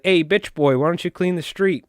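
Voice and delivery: a hint of mob tough guy; imitated Italian mobster. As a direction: order him about like a mock mobster. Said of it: "like, an Italian mob".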